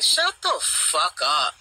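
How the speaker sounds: slow contemptuous shut-up; slow dismissive put-down. Real, not acted. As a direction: drag it out in disgust. Ranting at commenters.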